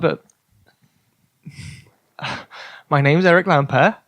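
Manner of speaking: ordinary delivery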